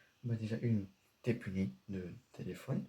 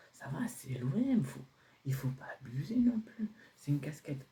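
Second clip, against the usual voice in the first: whispered, a bit higher, defensive; whispered protest; 4 semitones higher than usual. Acted. Son defending his cap to his dad, higher whisper.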